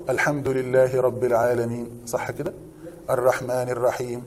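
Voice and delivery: slow, soft chanted Quran recitation. Shown as a demonstration. Teacher reciting the Fatiha to students, slow chanted recitation. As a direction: draw out each verse like a reciter.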